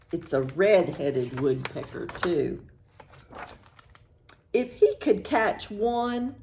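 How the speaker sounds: ordinary delivery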